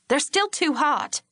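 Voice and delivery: slow it down with irritated emphasis; furious. A narrator's reading. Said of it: "Lori's tone was still furious".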